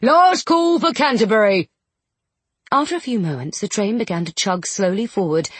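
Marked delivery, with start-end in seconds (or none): 0.0-1.7 high, loud call down the platform; conductor's shout. A narrator's reading. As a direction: bellow it across the station. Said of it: "The conductor leaned out and shouted".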